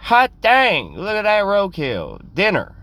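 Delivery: put-on high, hollering old farmer; high-pitched farmer exclamation, hammed-up. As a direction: whoop it out like an excited old farmer. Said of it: "by an old farmer who would have been like".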